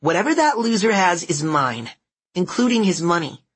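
put-on high, nasal, bossy kid; bossy nasal girl. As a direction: claim it like a playground bully. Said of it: "Her bossy nasal voice".

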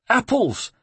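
"Apples." said very high and falling, in the monk's voice; "Apples." squeaked out by the scared monk, dropping at the end; monk's answer. Acted. A frightened monk answers Ezio with a high falling "Apples.".

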